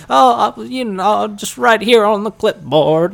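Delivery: put-on high, loud, slow old-timer voice; a creaky old man fumbling. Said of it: "And he goes".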